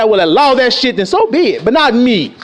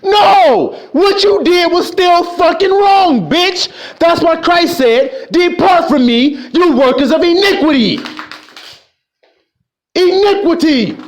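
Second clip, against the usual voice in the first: preacher thundering at sinners; thundering rebuke; 7 semitones higher, slower than usual. Real, not acted. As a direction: condemn them at full force. Preacher thunders against wrongdoers.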